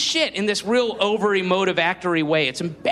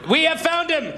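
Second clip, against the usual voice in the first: alien discovery cry; 7 semitones higher than usual. Pretend. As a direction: announce it like a future alien who found treasure. Aliens of the future announcing.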